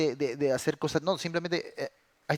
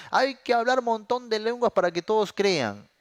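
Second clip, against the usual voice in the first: mocking believer; 10 semitones higher, 6 dB louder than usual. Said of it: "algunos piensan".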